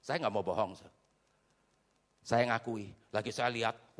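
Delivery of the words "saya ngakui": ordinary talk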